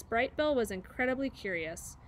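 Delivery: plain reading voice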